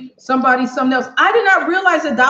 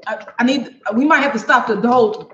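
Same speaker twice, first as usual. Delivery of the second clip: ordinary talk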